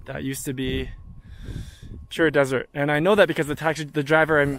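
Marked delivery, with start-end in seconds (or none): none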